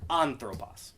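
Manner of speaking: ANthropos, weight on the "an"; stress on first syllable. Shown as a demonstration. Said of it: "that acute is on that first syllable".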